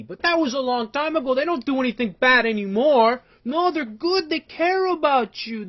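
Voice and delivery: high, loud sarcastic parody of a naive believer; mocking the host. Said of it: "everything to him is, is hunky-dory".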